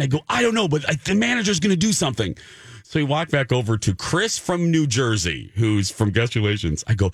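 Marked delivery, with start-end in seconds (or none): 0.3-2.4 high, rushed, excited explanation; reenacted giddy urgency; urgent excitement. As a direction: blurt it out in a hurry. Retold breathless urgency to husband.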